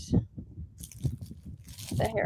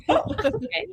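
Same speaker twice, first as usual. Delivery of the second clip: high, mocking "oh, okay"; lightly voiced disbelief; mock unimpressed; 11 semitones higher than usual. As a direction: mimic a deadpan reaction to a sudden death. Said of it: "I'm like".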